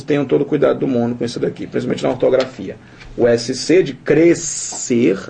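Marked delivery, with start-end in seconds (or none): 3.9-5.3 CRESCER broken slowly as CRES... CER; split pronunciation. Shown as a demonstration. Teacher showing that S and C are not said apart, split word. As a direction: draw out CRESCER, sounding S and C apart. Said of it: "nós não pronunciamos".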